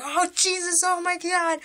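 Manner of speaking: high-pitched mock panic; imitated freaking out. Punk kid with two crocodiles on him, mock panicked. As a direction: cry out high as if panicking, retold. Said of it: "visibly freaking out".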